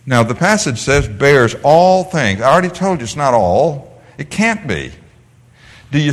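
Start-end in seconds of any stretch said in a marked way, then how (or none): 1.1-2.4 slow scripture quote. Shown as a demonstration. Quoting scripture slowly.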